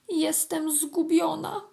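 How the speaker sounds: a small ant slowly giving up hope; despairing little ant. A narrator's reading. Ant alone in the rushing stream, despairing. Ant's despairing thought while drowning.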